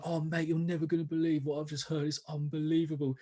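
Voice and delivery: soft mock London lad; a light London accent, hushed and amazed; teenage boy in a cubicle telling mates about a tune, London accent. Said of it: "classic London kind of accent".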